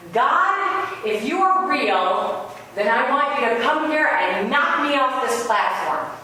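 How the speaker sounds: high, slow, theatrical dare flung at the sky; a lecturer calling God out before the class, drawn out; defiant challenge to God. Retold or imitated. Atheist professor dares God aloud.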